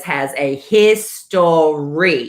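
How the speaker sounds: "history" stretched out syllable by syllable; slow, weighty "history" hinting at gossip; drawn-out emphasis. Real, not acted. Hinting at a juicy past.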